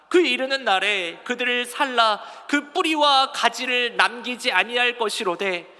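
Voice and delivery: slow, measured congregational reading; scripture reading aloud. Acted. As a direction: read out slowly and firmly for all to follow. (또박또박 느리게 성경 봉독)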